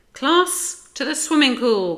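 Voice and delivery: high, loud teacher's command; rousing, high-pitched classroom call. A narrator's reading. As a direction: summon the whole class. Teacher calls the class along.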